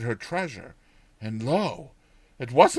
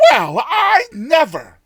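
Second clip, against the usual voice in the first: high, loud, slow astonished exclamation; amazed old woman; 12 semitones higher, 10 dB louder, slower than usual. Acted. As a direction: drag it out, dumbfounded. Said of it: "says she again".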